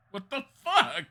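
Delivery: real shock at the screen; high, loud outburst of disbelief. Real, not acted. Startled by odd wolf graphics.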